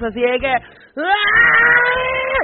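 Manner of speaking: high crying shout; released scream. Retold or imitated. At the Velo de Novia waterfall, a long wailing scream. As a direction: let out a long wailing cry. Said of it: "lloré, grité".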